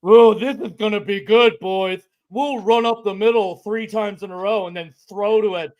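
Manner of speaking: mock a dim, drooling coach; thick, slobbery put-on dolt voice; drooling dopey coaches. Fan mocking coaches in their meetings, drooling dopey voice. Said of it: "drooling all over themselves".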